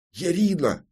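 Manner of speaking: high-pitched startled cry of a name; involuntary exclamation. A narrator's reading. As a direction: blurt out the name, pitch leaping then falling. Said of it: "не сдержался детектив".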